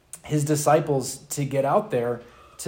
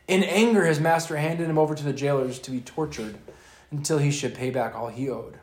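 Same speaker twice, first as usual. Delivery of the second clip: ordinary talk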